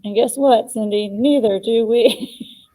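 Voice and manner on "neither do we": slow, laughing through the words. Real, not acted. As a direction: chuckle it out slowly. Jokes with listener Cindy.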